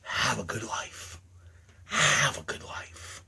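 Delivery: low, slow, near-whispered goodbye; icy murmured send-off; cold farewell. Acted. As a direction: let it drop to a hush. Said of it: "saying to every one of you".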